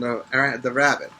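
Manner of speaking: usual speaking voice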